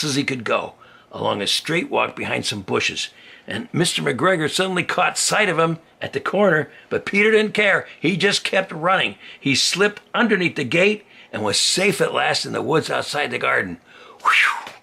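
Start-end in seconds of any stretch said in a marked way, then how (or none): 3.5-13.8 high, loud chase narration; excited. A narrator's reading. 14.2-14.7 breathy whew of relief; relieved whew. Acted. Relief after escape.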